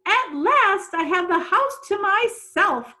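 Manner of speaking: high, loud triumphant cry; a fussy lady finally free of the cat; cried out in delight. A narrator's reading. Angela gloating over her empty house, high and loud. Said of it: "cried Angela Throgmorton".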